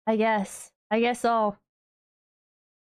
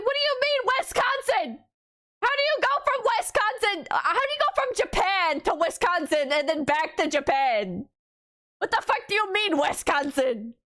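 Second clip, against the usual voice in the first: much higher, baffled outcry; hammed-up incredulity; mock disbelief; 8 semitones higher than usual. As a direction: sound utterly bewildered, pitched way up. Incredulous retort.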